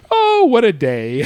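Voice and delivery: high, loud drawn-out Joker line; Jack Nicholson voice. Retold or imitated. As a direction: quote the Joker slowly and loudly. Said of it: "my favorite line delivery".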